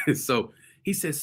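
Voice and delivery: normal talking voice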